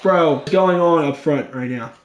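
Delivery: usual speaking voice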